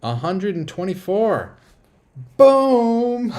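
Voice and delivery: loud, high reveal building to a booming shout; triumphant, drawn-out celebration; excited announcement. Real, not acted. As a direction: announce the big number slowly, then explode. Celebrates new subscriber count live.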